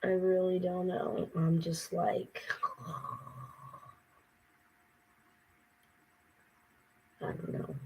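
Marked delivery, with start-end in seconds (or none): 1.3-7.8 exhausted whisper. Real, not acted. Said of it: "I'm exhausted".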